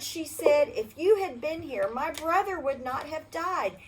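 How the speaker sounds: usual speaking voice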